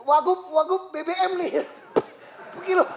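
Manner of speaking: high, loud mock of a brushed-off official, laughing through it. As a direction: laugh while aping a careless deputy governor. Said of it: "kayak Wagub B B M nih". (meniru pejabat sambil tertawa, nada tinggi dan keras)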